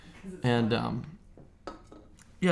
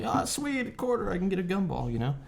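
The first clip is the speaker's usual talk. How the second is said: put-on excited kid; 7 semitones higher than usual. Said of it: "I'm like".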